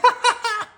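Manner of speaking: high, loud gloating laugh; mean bully laugh. A narrator's reading. Too Tall laughing at his prank, high mean laugh. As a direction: cackle at your own trick.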